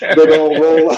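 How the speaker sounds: high, loud laughing words. Real, not acted. Teasing guest fetching her knives.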